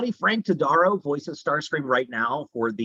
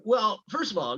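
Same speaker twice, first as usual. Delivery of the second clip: ordinary delivery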